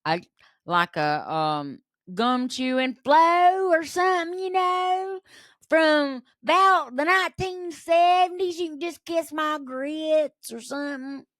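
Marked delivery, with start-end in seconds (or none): 2.1-11.3 high-pitched, gum-chewing waitress voice; put-on high character voice; Flo. Shown as a demonstration. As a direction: push it way up high like a sassy seventies diner waitress. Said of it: "if I could do a voice, it'd be like".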